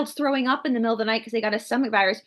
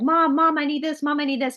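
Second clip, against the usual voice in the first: put-on whiny kids; 5 semitones higher than usual. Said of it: "The other two are like".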